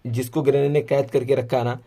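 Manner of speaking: ordinary talk, nothing marked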